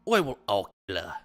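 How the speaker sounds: a hint of Butcher from The Boys, British accent; Butcher impression. Friend keeps reading the DMs as Butcher, British accent. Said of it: "he's like William Butcher".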